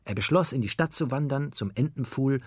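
usual speaking voice

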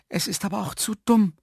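high-pitched cry of self-reproach; exasperated exclamation. A narrator's reading. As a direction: exclaim it high, annoyed at yourself. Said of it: "rief Herr Jaromir". (verärgerter, hoher Ausruf)

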